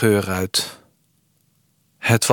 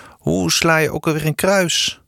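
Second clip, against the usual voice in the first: higher, a touch of nervous worry; anxious; 6 semitones higher than usual. Acted. Eefje asks Sanne, mildly anxious about giving herself away. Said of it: "vroeg Eefje angstig".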